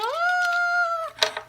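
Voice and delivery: very high, loud playful shriek; playful scream. Acted. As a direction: shriek like flying down a hill. Characters scream going downhill.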